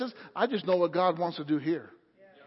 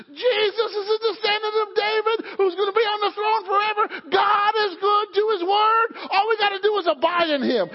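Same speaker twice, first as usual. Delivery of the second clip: high, loud, driving preacher's proclamation; full-force preaching; 9 semitones higher, 3 dB louder than usual. Real, not acted. Preacher proclaiming God's promise to the congregation, high and fervent. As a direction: preach it higher and harder, building to a shout.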